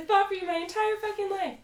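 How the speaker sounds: high-pitched impassioned mock protest; Jacqueline. Castmate who fought for her friend, mock impassioned protest. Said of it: "She's like".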